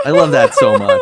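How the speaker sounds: laughing, squealing with delight. Real, not acted. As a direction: squeal it out with joy. Delighted at love story.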